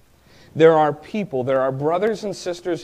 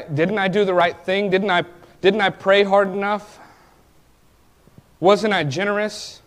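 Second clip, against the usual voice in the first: rising, louder pleading cry at God, higher; indignant prayer; 4 semitones higher, 4 dB louder than usual. Retold or imitated. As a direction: demand answers from God, voice climbing. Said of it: "You ever been that indignant in your prayer".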